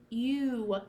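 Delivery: ordinary talk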